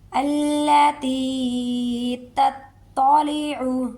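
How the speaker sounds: very slow melodic tilawat; Quran recitation. Shown as a demonstration. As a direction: recite slowly and clearly. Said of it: "recitation demo". (آہستہ تلاوت)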